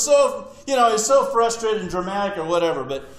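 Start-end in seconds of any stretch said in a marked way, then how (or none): none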